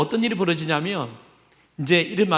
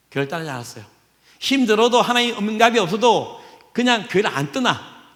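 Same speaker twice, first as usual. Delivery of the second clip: ordinary delivery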